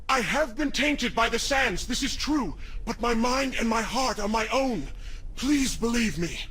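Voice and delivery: pleading through a distorted voice; earnest plea in an otherworldly timbre; altered monstrous voice. Acted. Tainted prince begs woman to believe him, distorted pleading.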